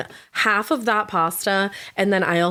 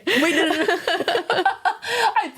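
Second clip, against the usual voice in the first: high voice breaking up with laughter; real laughing fit; 11 semitones higher than usual. Real, not acted. Podcast host cracking up at her own mix-up, laughing. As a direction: laugh helplessly through the words.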